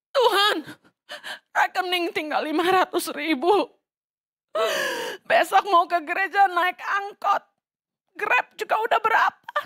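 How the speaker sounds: hammed-up sobbing plea about money; fake-cry to God over an empty account; mock crying prayer. Mocking a tearful prayer. (doa sambil pura-pura menangis)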